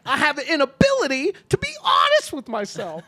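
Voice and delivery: high, loud comic anguish; mock yelling. Sketch bully admitting his trouble to bystander, mock yelling. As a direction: shout it as a hammed-up confession. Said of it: "Why are you yelling at me?".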